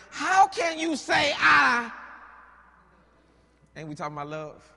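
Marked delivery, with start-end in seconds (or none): 0.1-1.9 slow loud shout, pitched high; Delilah's angry cry. Acted. Delilah confronting Samson, slow high shout. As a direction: hurl it back at him. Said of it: "And she said unto him".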